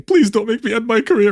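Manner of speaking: high-pitched imitation of tearful begging; mock crying. Man begs to keep his career, mock tearful. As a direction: plead like a hammed-up crybaby. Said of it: "he started crying, saying".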